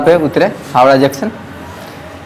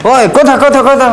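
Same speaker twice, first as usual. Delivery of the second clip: Bengali shout; 8 semitones higher than usual. Retold or imitated. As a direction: bark kotha kotha like a Kolkata conductor. Bengali conductor yelling at him.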